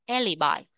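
"alibi" stressed on AL, AL-i-bye; stress on first syllable. Shown as a demonstration. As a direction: hit the first syllable of "alibi", then let it fall. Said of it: "Nhấn âm một". (nhấn âm một của "alibi")

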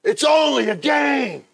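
high, loud, drawn-out yell; imitated yelling. Hiding players call out to Steve, imitated yelling. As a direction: yell it slowly from afar, pleading. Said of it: "And they're yelling".

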